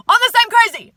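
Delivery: sudden loud hype burst; loud, excited cheerleading; hyping up. Real, not acted. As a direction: shout it to hype up the listeners. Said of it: "I'm just here to hype you up".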